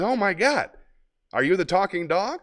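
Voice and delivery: amazed man. Acted. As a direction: play the man stunned by a talking dog. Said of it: "and he says".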